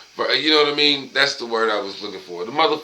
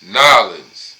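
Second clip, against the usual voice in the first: imitated New Orleans accent, "Nolens" squeezed into two syllables; loud, falling New Orleans "Nolens", mimicking the principal; thick New Orleans accent; 3 semitones lower, 6 dB louder than usual. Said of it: "her accent was thick as fuck".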